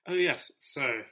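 normal talking voice

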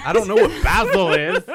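very high, loud, laughing confession; laughing admission. Real, not acted. Friend laughingly admitting he doesn't know basil. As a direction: crack up as you admit it. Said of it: "self-correction".

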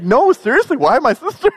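very high, loud insistence; insistent brother. Retold or imitated. Brother insisting, very high and loud. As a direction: press the question again, squeaky. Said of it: "He's like".

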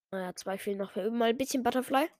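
ordinary talk

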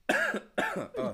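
coughing on water that went down wrong; choking cough. Real, not acted. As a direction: sputter and cough after swallowing wrong. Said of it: "finish choking on the water that you are drinking".

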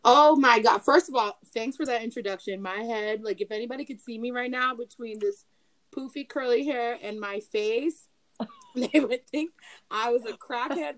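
ordinary talk, nothing marked

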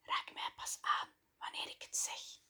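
whispered command, very soft. A narrator's reading. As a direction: hush the order into her ear. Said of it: "fluisterde ze verder". (gefluisterd bevel)

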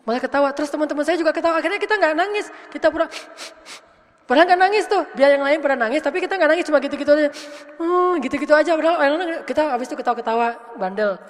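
loud, high and fast, bubbling with laughter; laughing retelling. Retold or imitated. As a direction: tell it loudly and quickly, cracking up at the memory.

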